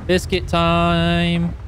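slow stretched sing-song call of biscuit time; drawn-out announcement. Pretend. Streamer announcing a snack break, slow sing-song. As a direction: savour each word like a treat.

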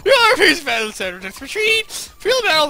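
high, hammed-up wailing rush; frantic report. Fairy reporting the attack, high frantic mock distress. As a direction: blurt the bad news in mock distress. Ren blurting battle report.

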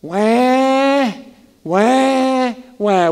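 mock baby wail wah wah; hammed-up crybaby wail; mock crying. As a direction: wail like a whining toddler. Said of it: "We do it too".

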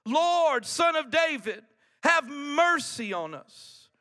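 loud, high cry for mercy; shouted plea. Retold or imitated. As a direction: call out high over a crowd, drawn out at the end. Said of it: "they shouted".

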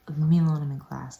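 snapping, loud at the start; mock irritated outburst; retold snap. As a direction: snap it, then drop off. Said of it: "like, snapped".

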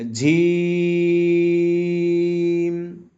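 "জিম" with a firm j and very long vowel; hard-onset "জিম", stretched right out to the m; hard Arabic jim. Shown as a demonstration. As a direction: chant "জিম" slowly on one steady note. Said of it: "সবাই পড়েন".

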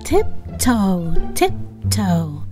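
plain reading voice

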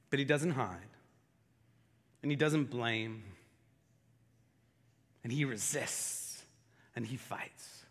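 low, soft, reverent preaching; solemn quiet preaching; hushed sermon. Acted. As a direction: draw them in quietly, letting each phrase land.

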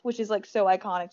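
normal talking voice

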